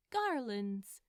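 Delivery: ordinary delivery, nothing marked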